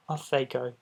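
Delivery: usual speaking voice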